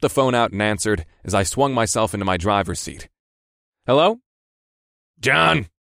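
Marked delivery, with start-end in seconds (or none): 3.9-4.2 high, loud, bright-spirited phone pickup; cheery greeting. Acted. Driver answers his ringing phone in good spirits, cheery. 5.2-5.7 high, gruff bark of a boss; gravelly bark. A narrator's reading. Boss barks at his driver over the phone, gravelly. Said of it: "My boss barked in his usual gravelly voice".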